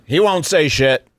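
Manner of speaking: ordinary talk, nothing marked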